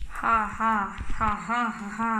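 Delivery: low villain chuckle; evil monster laugh. Acted. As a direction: laugh like a gloating beast. Giant laughs.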